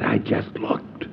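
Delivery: slow hushed whisper; whispered deadpan. Retold or imitated. Howard answering about his watch, slow whisper. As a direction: answer flatly under your breath.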